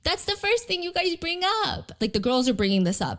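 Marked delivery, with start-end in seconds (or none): 0.0-1.9 higher-pitched playful protest; teasing objection in a raised voice; lighthearted complaint. Retold or imitated. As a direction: imitate a girl lightly calling someone out. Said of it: "very lighthearted".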